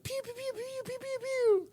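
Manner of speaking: very high pew-pew laser noises; mock blaster sounds. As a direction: squeak out the pews like a toy gun. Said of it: "where she goes like".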